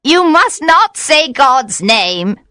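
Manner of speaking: high, slow, urgent cry; witch's protest. A narrator's reading.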